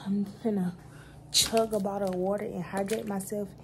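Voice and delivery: ordinary delivery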